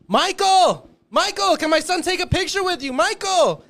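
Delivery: high, loud yelling to get attention; eager fan hollering a name; calling out. Retold or imitated. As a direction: shout across the crowd at him.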